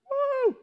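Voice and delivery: short, very high whoop; mock cheering woo. Preacher to congregation about their imagined army, cheering woo. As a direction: let out a high, loud little woo.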